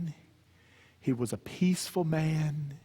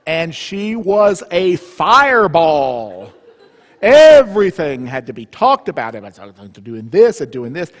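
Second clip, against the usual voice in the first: louder, higher, laughing through it; lively excited; 3 semitones higher, 14 dB louder than usual. Real, not acted. Teller describing his fiery mother, loud and laughing. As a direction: paint her as a fireball.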